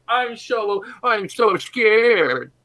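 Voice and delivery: high, loud, very slow slurred mock fear; puddle voice. Melted creature calling from the puddle, slurred fake terror. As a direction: ooze the fake fright out, drawn long. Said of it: "hear the voice coming from the puddle".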